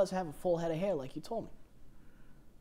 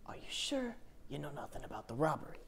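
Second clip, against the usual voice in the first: softer, whispered threat; 8 dB quieter than usual. Acted. Detective presses the suspect about the robbery in a whisper. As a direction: lean in and whisper the question.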